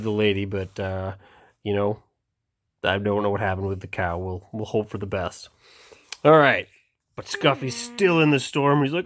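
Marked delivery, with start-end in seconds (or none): none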